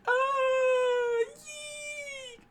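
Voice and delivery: very high shrieking scream; crowd scream. Acted. Crowd screaming at the shooting, very high. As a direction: shriek and fade into a squeal. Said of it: "And the crowd screamed".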